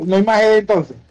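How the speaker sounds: normal talking voice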